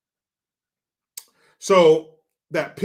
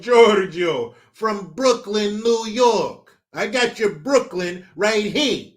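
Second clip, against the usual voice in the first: put-on loud, high-pitched Brooklyn accent; put-on New York accent, pitched up; 7 semitones higher, 5 dB louder, slower than usual. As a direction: belt it out in a big Brooklyn voice. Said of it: "give us your New York accent".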